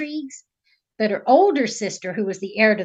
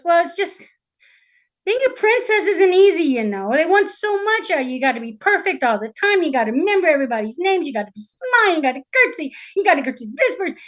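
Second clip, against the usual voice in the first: high, swooping, put-upon voice; exasperated princess rattling off grievances; whining princess complaining; 6 semitones higher, faster than usual. Acted. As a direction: pour out the burdens of royal life.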